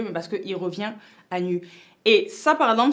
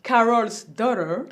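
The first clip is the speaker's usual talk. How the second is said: Carol's Daughter said with a self-conscious English accent, slowly; slow English accent, half laughing at herself; 3 dB louder, slower than usual. Real, not acted. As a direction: try the English brand name in an English accent, unsure of it. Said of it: "ne vous moquez pas de mon accent".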